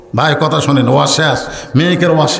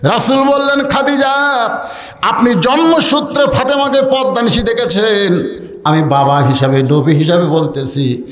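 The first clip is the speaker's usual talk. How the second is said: melodic waz preaching; 7 semitones higher than usual. A narrator's reading. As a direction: chant it like a preacher, holding the high note. Waz preacher chants the narration.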